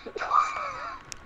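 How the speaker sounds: very high, whooping laugh; real squealing laugh; high shout of laughter. Real, not acted. Listener laughs at the story.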